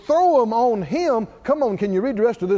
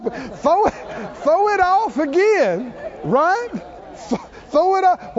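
fervent preaching; 8 semitones higher than usual. Acted. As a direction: push it out like a revival call. Pastor urging congregation, rising fervor.

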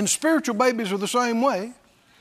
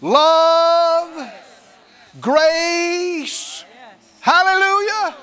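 preaching; 5 semitones higher, 4 dB louder, much slower than usual. Acted. Preaching peak.

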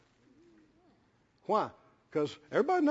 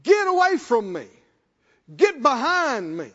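Jesus sternly rebuking Peter; stern rebuke; 2 semitones higher, 4 dB louder than usual. Retold or imitated. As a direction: cast Satan away sharply. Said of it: "Jesus turned to Peter and said".

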